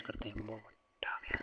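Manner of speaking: whispered aside to himself; whispered self-praise over the new colour. Real, not acted. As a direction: breathe the words with no voice, pleased. Teacher muttering to himself.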